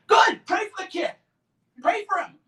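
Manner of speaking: bursting out high and loud; sudden high exclamation. Real, not acted. Answering offended Christians with a loud high cry. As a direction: snap back loud and high.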